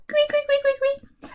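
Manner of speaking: high tweeting bird calls; teacher imitates a bird for the class; bird chirps. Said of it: "Il y a l'oiseau".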